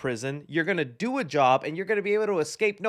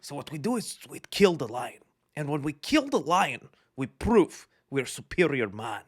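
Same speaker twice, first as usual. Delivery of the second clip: lightly hammed-up tough-guy preaching; Red Pill podcaster; 4 semitones lower than usual. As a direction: lower mock macho podcast-bro voice. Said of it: "He probably has his own Red Pill podcast".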